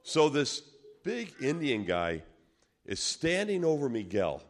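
ordinary talk, nothing marked

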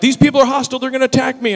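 loud, high hysterical imitation; hammed-up cry of alarm; acted frantic complaint. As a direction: mimic a woman calling for backup. Woman's panicked complaint on phone.

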